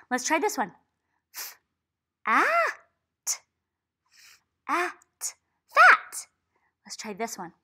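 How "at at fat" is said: at, at drawn out, then fat high and loud; sounding out fat. Shown as a demonstration. Teacher blending sounds into fat for a child. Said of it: "sounding out a word".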